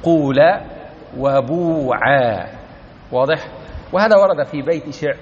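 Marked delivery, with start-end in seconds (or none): 1.2-2.5 قولا and وبوعا drawn out slowly, with a full long u; teacher slowly demonstrating قولا وبوعا; slow Hudhayl forms. Shown as a demonstration. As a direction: stretch each word slowly to show the long u. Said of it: "نحو قول".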